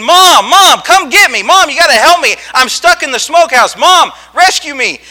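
high, loud yells for Mom; screaming for help. Retold or imitated. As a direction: cry out like a trapped child calling for rescue. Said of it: "I'm screaming. I am yelling".

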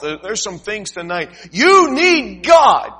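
loud, slow altar-call cry; preaching shout. Acted. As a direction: build and shout the appeal.

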